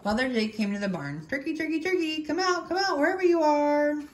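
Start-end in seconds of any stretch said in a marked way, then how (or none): 1.3-4.0 high singsong call. A narrator's reading. Farmer Jake searching the barn for Turkey, calling out. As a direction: call high, stretching the last word.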